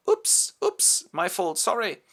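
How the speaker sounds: very high startled oops; startled apology. Acted. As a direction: yelp then apologise. Near collision at the door.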